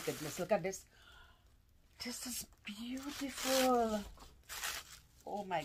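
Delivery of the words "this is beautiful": breathless slow whisper of wonder; awed whisper. Real, not acted. Unboxing a friend's gift, a slow awed whisper. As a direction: hush it like it's precious.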